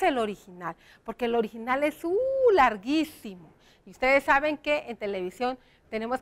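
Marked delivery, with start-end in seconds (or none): 2.5-3.5 "larguísimo" with a long stressed "guí". Real, not acted. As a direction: stretch "larguísimo" to show sheer length. Stressing how long the original is.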